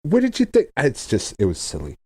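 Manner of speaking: ordinary talk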